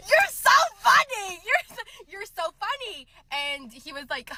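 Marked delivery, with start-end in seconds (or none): 0.1-3.1 very high, squealed mock amusement; forced amusement. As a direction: wail it like hammed-up fake laughter. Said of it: "you just had to sit there".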